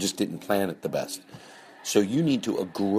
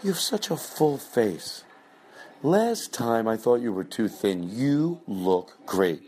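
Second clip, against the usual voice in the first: sugary slow hostess flattery; slow fake compliments; 3 semitones higher, slower than usual. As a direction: savour each backhanded compliment. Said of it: "That's the bit we were doing".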